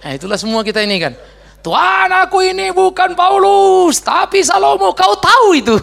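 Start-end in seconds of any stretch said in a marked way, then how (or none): 1.6-5.8 high, loud, slow mock-pleading to God; hammed-up whiny petition to the Lord; mock whiny prayer. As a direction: ham up a spoiled believer bargaining with God. Mock prayer of a demanding believer.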